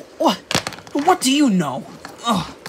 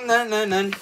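normal talking voice

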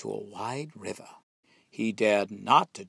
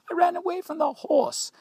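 much higher singsong line; taunting squeal; 5 dB louder than usual. A narrator's reading. Gingerbread boy boasts to threshers in a high squeal.

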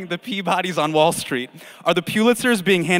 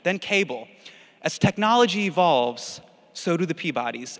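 ordinary talk

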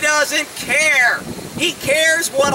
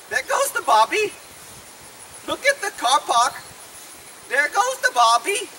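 hammed-up British accent, lifted pitch; mock British accent; 3 semitones higher than usual. Teaching the horse British, mock British accent. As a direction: put on a silly British accent. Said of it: "I'll teach him some British".

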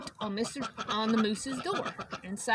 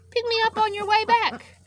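high Porcupine voice; 11 semitones higher, 8 dB louder than usual. Acted.

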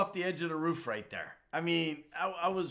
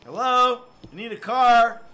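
loud, higher impatient call; 8 semitones higher, 11 dB louder than usual. Real, not acted. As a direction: shout for a car, fed up. Shouting for a car in game.

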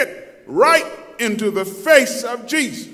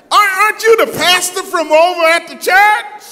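high, loud acted astonishment; mock surprised recognition; 9 semitones higher, 6 dB louder than usual. Someone spotting the pastor in a strip club, mock surprised call. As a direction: call out as if spotting someone you never expected.

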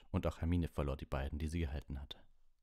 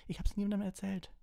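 very high-pitched, accusing shriek; 4 dB louder than usual. A narrator's reading. Hermine accusing Lupin, shrieking. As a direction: screech it at the top of your range. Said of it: "kreischte Hermine".

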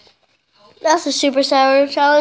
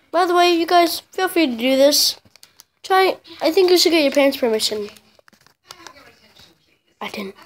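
ordinary talk, nothing marked

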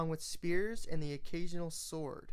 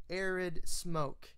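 ordinary delivery

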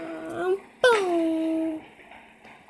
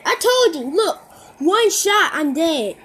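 frustrated outburst; 2 semitones higher, 9 dB louder than usual. Real, not acted. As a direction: vent about getting one-shotted. Complaining about one-shot kill.

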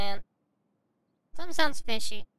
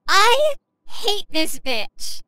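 slow; 3 semitones higher, much slower than usual. Real, not acted. Annoyed at game character.

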